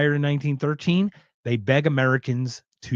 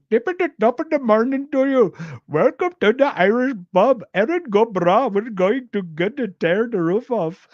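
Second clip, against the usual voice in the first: high, loud, hammed-up Indian accent; sing-song silly sandwich-board pitch; mock Indian accent; 9 semitones higher, 4 dB louder than usual. Imitating the Indian sign man outside the Irish pub, mock accent. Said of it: "I just go".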